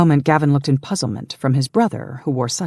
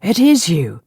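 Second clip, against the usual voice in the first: higher, louder voice of sudden recognition; astonished recognition; 6 semitones higher, 6 dB louder than usual. A narrator's reading. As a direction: realize with delight who it is. Guard recognizes his lost lord.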